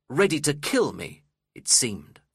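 ordinary delivery, nothing marked

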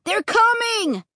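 high, drawn-out warning cry; alarmed shout. A narrator's reading. Boy shouts to his family as the horrors chase. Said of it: "Luke shouted suddenly".

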